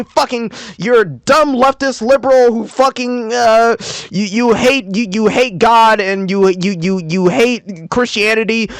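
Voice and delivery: mock angry commenter. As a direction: spit out insults like an online troll. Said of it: "time to start typing mean comments now".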